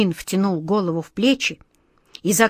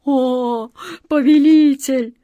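higher, louder moaning plea; pleading moan; 5 semitones higher, 4 dB louder, slower than usual. A narrator's reading. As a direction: groan out a desperate plea. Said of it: "с мольбой простонал Джин". (с мольбой простонал)

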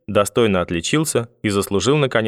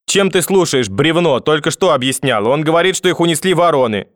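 raised, high-pitched exasperated retort; grandmother yelling impatiently; 6 semitones higher, 4 dB louder than usual. A narrator's reading. Grandmother repeating herself to grandfather, impatient yelling. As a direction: snap at him with pitch pushed up. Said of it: "Да чё ты орёшь сразу?".

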